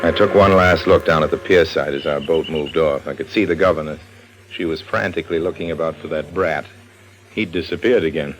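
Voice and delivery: low, even first-person narration; low storyteller voice of a radio drama. A narrator's reading. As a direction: tell it as the hero looking back.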